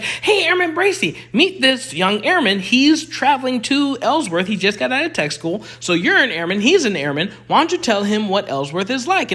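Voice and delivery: a touch of a chirpy senior NCO; sergeant's intro. Retold or imitated. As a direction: lightly voice the sergeant introducing the airmen. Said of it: "she's like".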